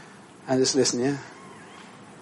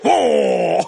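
high, then drawn-out goofy whoa; stupidly awed men. Retold or imitated. Guys ogling a woman walking onto the plane, stupidly awed. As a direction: mimic gawking men gone stupid. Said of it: "they get stupid".

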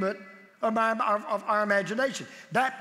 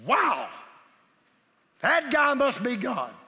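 a drawn-out, higher, louder "Wow" of awe; mock amazement; 4 semitones higher, 4 dB louder, slower than usual. Preacher imitating someone meeting Jesus, mock awed. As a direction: play the starstruck onlooker, slowly. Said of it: "You'd have gone".

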